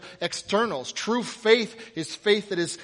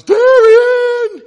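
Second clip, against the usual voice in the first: full-blast high shout of a name; yelling out; 20 dB louder than usual. Acted. Fan spotting the quarterback in a restaurant, yelling. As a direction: holler it across the room. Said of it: "yells out".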